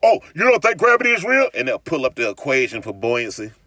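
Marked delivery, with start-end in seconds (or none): 0.0-1.5 mocking globe believer. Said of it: "what them Globes used to say".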